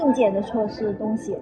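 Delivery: ordinary talk, nothing marked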